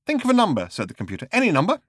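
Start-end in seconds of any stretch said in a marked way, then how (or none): 0.1-0.7 high, bright computer voice; eager computer; ship's computer. Acted. 1.3-1.8 high, bright computer voice; eager computer; ship's computer. Acted.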